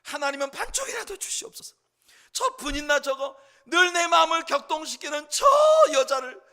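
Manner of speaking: loud, high pleading cry to God; desperate prayer. Acted. Hannah praying for a son out of jealousy, loud pleading. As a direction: beg God at the top of the voice. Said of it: "엎드려 울기 시작한 거예요".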